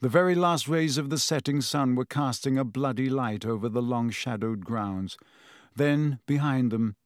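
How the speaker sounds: plain reading voice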